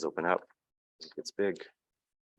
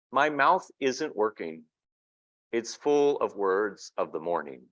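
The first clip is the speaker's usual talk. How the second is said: measured storybook narration, a touch slower; picture book reading; 4 semitones higher than usual. A narrator's reading. Reading a picture book aloud to an audience, storybook narration. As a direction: read the child's words gently to the room.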